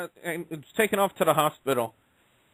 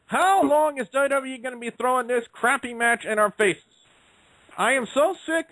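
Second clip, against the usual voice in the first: exasperated rant; 6 semitones higher than usual. Real, not acted. Ranting about a boring match.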